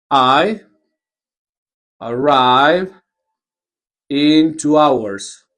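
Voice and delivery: slow, spelled-out delivery of "in two hours"; slow model answer. Shown as a demonstration. Teacher modeling the answer to students, slowly. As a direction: draw out each word for learners. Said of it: "slow modeled answer".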